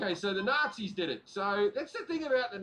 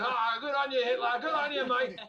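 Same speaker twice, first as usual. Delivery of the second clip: mock Aussie; 5 semitones higher, 5 dB louder than usual. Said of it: "And they went".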